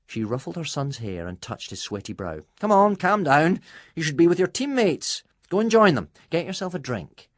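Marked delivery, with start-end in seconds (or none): 2.6-7.3 high, loud motherly command; firm mother. A narrator's reading. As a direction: hush him firmly but brightly. Mother firmly calming her son.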